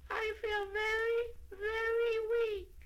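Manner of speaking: very high tiny voice fading, slow; fainter and fainter. A narrator's reading. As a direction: let the little voice dwindle slowly. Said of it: "His voice got fainter and fainter".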